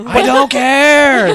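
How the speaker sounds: loud, slow, drawn-out cry; dramatic slow shout; mock outburst. Real, not acted. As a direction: throw it out loud and long. Sudden outburst over the chatter.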